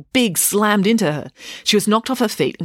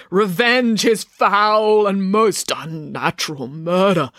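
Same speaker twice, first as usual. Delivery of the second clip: slow, raised, drawn-out ghostly order; intoned ghostly command, every word stretched; 4 semitones higher, 3 dB louder, slower than usual. A narrator's reading. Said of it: "ordered the ghost".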